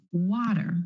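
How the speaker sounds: "water" with the T flapped like a quick D. Shown as a demonstration. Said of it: "Do you hear that flap T or the D sound?".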